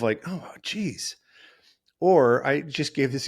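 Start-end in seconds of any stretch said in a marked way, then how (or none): 0.3-1.2 disgusted sigh. Retold or imitated. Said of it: "it's this tone of".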